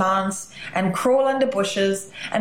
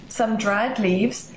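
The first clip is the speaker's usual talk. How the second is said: ordinary delivery, nothing marked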